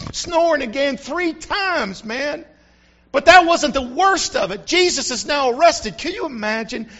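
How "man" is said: ordinary talk, nothing marked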